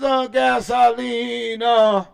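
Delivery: drawn-out song title. Real, not acted.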